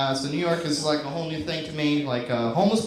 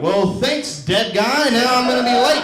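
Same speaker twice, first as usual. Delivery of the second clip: jaded annoyance; 5 semitones higher, 4 dB louder, slower than usual. Pretend. Jaded New Yorker.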